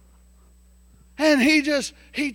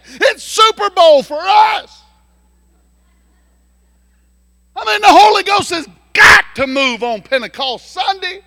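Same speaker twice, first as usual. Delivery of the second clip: higher and louder, building to a shout; fired-up preaching; 7 semitones higher, 7 dB louder than usual. Acted. Pastor hyping the church for Pentecost Sunday, shouting. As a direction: preach it like the Super Bowl of church.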